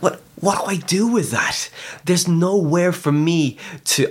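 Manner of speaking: high-pitched, mock-bewildered younger self; retold astonishment. Retelling his astonished younger self.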